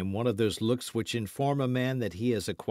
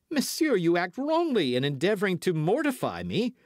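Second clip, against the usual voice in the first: put-on high, raised-voice young man's objection; quarrelsome; 9 semitones higher, 3 dB louder than usual. A narrator's reading. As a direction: bristle and speak up, offended. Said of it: "began to speak more loudly".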